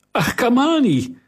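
high, sudden call of a name; surprised cry. Acted. Kariline spots the boy entering and calls out. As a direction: recognise him with a start.

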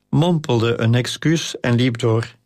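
ordinary delivery

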